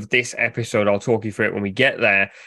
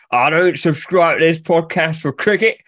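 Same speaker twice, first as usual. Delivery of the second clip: higher, louder mock-grumpy fan; imagined grumpy fan; 6 semitones higher, 4 dB louder than usual. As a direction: play a put-out listener for a laugh. Voicing an imagined annoyed football fan.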